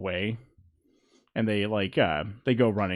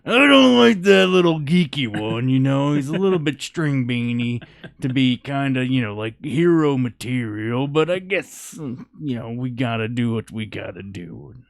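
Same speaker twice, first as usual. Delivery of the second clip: doubtful sizing-up; 3 semitones higher, 6 dB louder than usual. Acted. Townsperson openly sizing up player.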